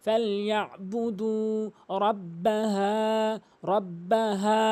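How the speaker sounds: ফালইয়াবুদু with long দু, রাব্বাহা with long হা; elongated recitation. Shown as a demonstration. Teacher models for learners, measured long-vowelled রাব্বাহা. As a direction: chant slowly, drawing out the last vowels of ফালইয়াবুদু and রাব্বাহা. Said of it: "অবশ্যই আপনি এক আলিফ টানবেন".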